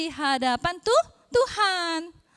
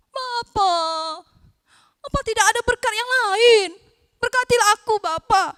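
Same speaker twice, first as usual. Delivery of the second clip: higher, louder desperate begging; desperate plea; 7 semitones higher, 8 dB louder than usual. Acted. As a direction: press him hard for another blessing. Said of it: "Dia meraung-raung, dia sangat marah".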